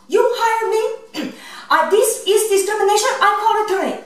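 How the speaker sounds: high, indignant put-on Japanese accent; mock Japanese accent. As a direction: threaten a lawsuit in a fake Japanese accent. Prank caller protests discrimination.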